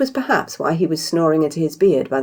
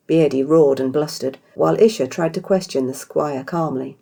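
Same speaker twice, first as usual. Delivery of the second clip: ordinary delivery, nothing marked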